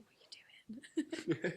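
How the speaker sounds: slow, hushed whisper of disbelief; a slow, retold whisper asking what's going on; baffled whisper. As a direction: whisper it slowly, puzzled. Boyfriend Jacob's baffled reaction, retold.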